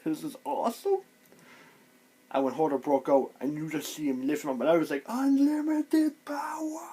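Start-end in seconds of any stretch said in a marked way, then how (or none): 0.0-1.0 high-pitched awed delight; thrilled reaction. Retold or imitated. Fan retelling his reaction to Bran's takeover, high and thrilled. 5.1-6.9 very high, drawn-out strained cry; mock power cry. Fan cheering Hodor breaking out, high drawn-out mock power cry.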